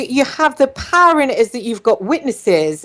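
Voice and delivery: ordinary talk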